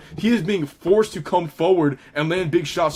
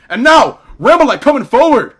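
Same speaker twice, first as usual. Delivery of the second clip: much higher, louder excited call; 7 semitones higher, 10 dB louder than usual. Real, not acted. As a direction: call out the surge with energy. Excited live call, fighter surges.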